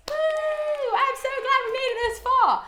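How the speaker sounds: high, loud whoop of relief; excited cheer. Real, not acted. Vlogger to viewers after finishing the book, high excited whoop. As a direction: cheer like you crossed a finish line.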